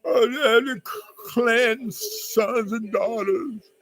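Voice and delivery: high, drawn-out weepy whine; mock tearful. Lamenting over sons and daughters, mock tearful. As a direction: stretch it out through pretend sobs. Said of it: "it makes me wanna cry".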